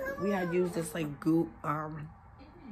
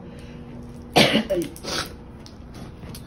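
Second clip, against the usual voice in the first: hard coughing from the Lysol spray; coughing fit; 4 dB louder than usual. Real, not acted. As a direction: cough it out for real, a few seconds. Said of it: "And I'm coughing from it".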